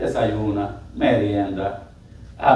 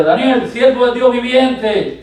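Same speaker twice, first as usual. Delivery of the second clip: high, slow, raised call; preacher's drawn-out high cry; 9 semitones higher, 5 dB louder, slower than usual. Acted. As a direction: re-enact the king calling out. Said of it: "Le dijo".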